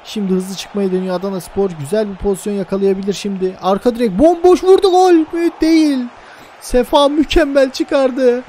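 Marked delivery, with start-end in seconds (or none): 3.6-8.4 excited. Real, not acted. Near goal chance in commentary.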